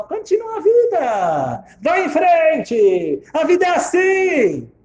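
high, slow, stretched-out calls; mocking platitudes. Old woman quoting what everyone says, mocking. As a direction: mimic everyone's hollow cheer, drawn out. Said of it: "todo mundo foi pra você e falou".

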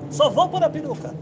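high, loud hurried wife; wife rushing. Acted. The wife answering her husband's rush, high and loud. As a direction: hurry up, still fixing the wig.

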